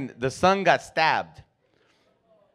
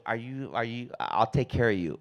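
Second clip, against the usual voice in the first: soft, low and gentle; soothing lady; 5 semitones lower, 9 dB quieter than usual. Retold or imitated. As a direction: reassure the girl softly.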